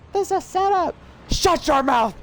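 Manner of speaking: high, loud mocking cop voice; snarling cop. Cop arresting the reporter, high mocking bark. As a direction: sneer it down at the prisoner, then snap. Cop mocks and silences prisoner.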